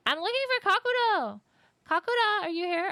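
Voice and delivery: high, loud sing-song call. Real, not acted. Streamer searching the bathroom, loud calling.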